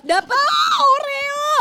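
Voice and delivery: delighted cry at getting Oreo; excited squeal. Real, not acted. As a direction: squeal over the prize. Thrilled to find Oreo.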